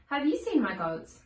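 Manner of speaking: ordinary delivery, nothing marked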